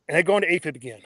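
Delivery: ordinary talk, nothing marked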